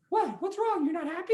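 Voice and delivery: high, anxious little mouse voice; worried mouse voice. Acted. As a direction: squeak out concern. Mice worried.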